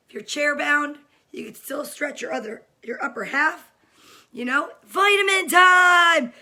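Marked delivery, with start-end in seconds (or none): 4.8-6.3 loud, slow call of vitamin time; calling out that it's vitamin time; announcing. Real, not acted. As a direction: sing it out like an announcement.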